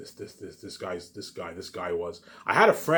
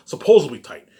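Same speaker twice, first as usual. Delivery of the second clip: scornful loud jab; 6 semitones higher, 13 dB louder than usual. Real, not acted. Mocking former friends' claims.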